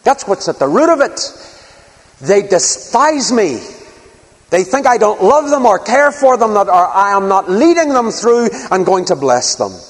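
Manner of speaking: high, forceful aggrieved protest; acted indignation. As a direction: preach it as God's hurt rebuke. God's anger voiced by preacher.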